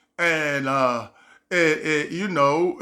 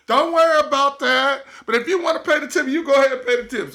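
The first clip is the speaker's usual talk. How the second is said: voicing the woman; 8 semitones higher, faster than usual. Woman voiced, quick.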